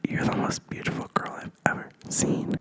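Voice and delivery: soft breathy whisper, barely there; whispered sweet talk. Retold or imitated. Guy whispering compliments to her in bed, whispered. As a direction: whisper it close like a creepy admirer.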